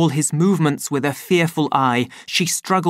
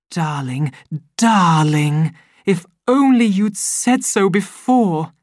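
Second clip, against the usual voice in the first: seductive Lenina; 5 semitones higher, 5 dB louder than usual. A narrator's reading. Lenina coaxing the savage, growing eager. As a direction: coax him, then gush.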